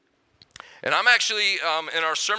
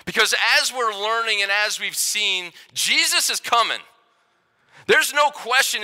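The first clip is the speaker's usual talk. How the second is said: rousing preaching; 3 semitones higher, 4 dB louder than usual. Acted. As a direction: lift the room and ask for agreement. Rousing the congregation.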